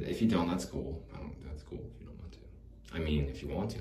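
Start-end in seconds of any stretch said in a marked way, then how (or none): 1.1-2.4 fast, hushed, half-swallowed backpedal; nervous mumble. Acted. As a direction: rush it under your breath, losing nerve. Nervous backpedaling on date offer.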